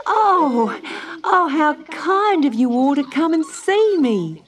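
put-on high, gushing little-girl Dot; delighted cry. A narrator's reading. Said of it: "cried Dot".

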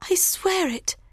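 very high woman's oath; high-pitched, innocent vow; pleading young bride. A narrator's reading. Hero swears her innocence.

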